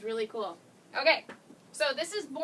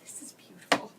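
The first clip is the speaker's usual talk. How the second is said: awed whisper. Real, not acted. Admiring the finished transfer.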